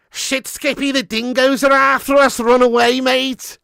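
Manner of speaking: hammed-up Scouse-Aussie shout; Scouse-Aussie accent. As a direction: Liverpool voice with a thick Aussie twang, yelling to run. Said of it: "Scouse accent that's been infused with twenty-five years of Aussie influence".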